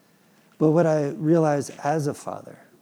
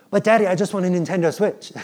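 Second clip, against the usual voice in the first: put-on higher, louder begging kid; whiny son; 6 semitones higher, 8 dB louder than usual. Son begging dad for a Switch, whiny. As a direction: plead like a boy wanting a toy.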